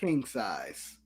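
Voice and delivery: low indulgent murmur; savoring voice. Real, not acted. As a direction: say it like a luxury ad. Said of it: "why are you saying it like that?".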